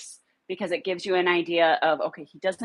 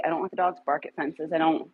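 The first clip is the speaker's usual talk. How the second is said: usual speaking voice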